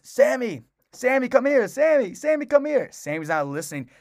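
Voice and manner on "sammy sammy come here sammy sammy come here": high, loud repeated dog call. Retold or imitated. Older man calling his dog that won't listen, high insistent call. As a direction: imitate an owner hailing a dog that ignores him.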